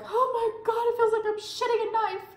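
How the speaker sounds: high-pitched panicked wail in labor; panicked high squeal. Retold or imitated. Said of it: "and I was like".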